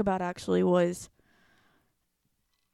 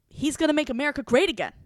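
higher and louder, a mocking singsong repeat of the slogan; huffy retort; 7 semitones higher, 6 dB louder, faster than usual. Imitating the stubborn classmate repeating the slogan. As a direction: mimic her stubborn slogan, pitched up. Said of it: "she gets huffy with me".

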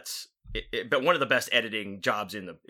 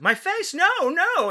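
much higher, louder squealing victim voice; mock victim shriek; 5 dB louder, slower than usual. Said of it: "She's like".

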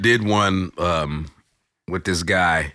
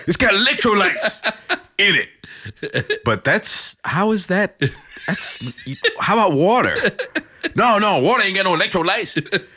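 high, laughing mock dimwit voice; laughing dimwit impression; Idiocracy character voice; 11 semitones higher than usual. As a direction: squeal it out through laughter like a dopey movie character. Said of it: "What was that movie".

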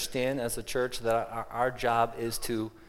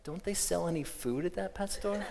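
mock weak voice; 7 semitones higher, 6 dB quieter than usual. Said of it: "in a very weak voice".

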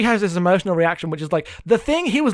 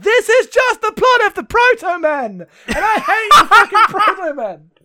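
much higher, slow laughing shout; laughing rant; 13 semitones higher, 5 dB louder than usual. Retold or imitated. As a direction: rant at the plot, laughing and loud. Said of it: "And I'm like".

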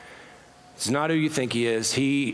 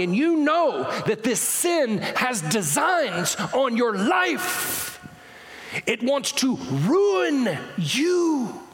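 fiery preaching; 14 semitones higher, slower than usual. Acted. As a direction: preach it hard to the congregation. Preacher's climax at full force.

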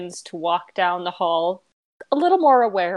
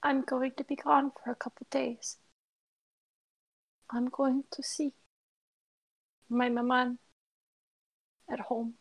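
high, quiet and fragile; soft high girl voice; 5 semitones higher, 6 dB quieter than usual. Acted. Timid girl telling her friend she is leaving, soft and high. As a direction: speak gently as the timid girl. NPC explaining absence softly.

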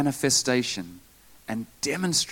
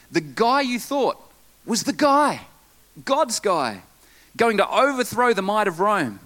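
raised, high-pitched preaching; emphatic pulpit delivery, pitched well up; impassioned preaching; 8 semitones higher, 4 dB louder than usual. Real, not acted. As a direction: press the case with rising urgency. Preaches the disciples' lost hope.

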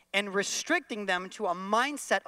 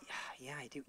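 sheepish admission; 5 semitones lower, 17 dB quieter, faster than usual. Pretend. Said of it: "you're gonna go".

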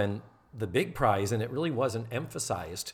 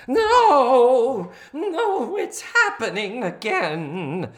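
hammed-up high, loud, drawn-out wail; Missus Garrett; 11 semitones higher, 8 dB louder, much slower than usual. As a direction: wail it slowly in mock despair. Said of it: "I won't do it in that voice".

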